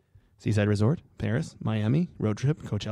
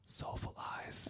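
breathy whisper; 23 dB quieter, much slower than usual. Acted. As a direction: whisper it softly. Reading quiz option.

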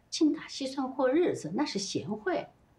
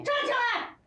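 sharp, high-pitched order; angry command; 12 semitones higher than usual. Acted. Friend orders the cowering husband up, angry command. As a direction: demand that he stand, pitch leaping up.

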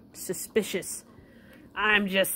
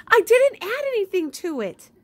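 much higher, louder put-on voice; pet voice; 13 semitones higher, 7 dB louder than usual. Owner protesting for the pet, high and squeaky.

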